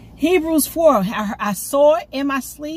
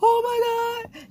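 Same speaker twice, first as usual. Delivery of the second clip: much higher, tearful joyful cry; overjoyed cry; 13 semitones higher than usual. Retold or imitated. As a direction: burst out near tears with joy. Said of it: "I was like".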